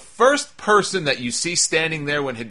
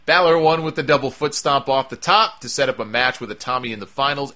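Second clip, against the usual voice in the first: ordinary talk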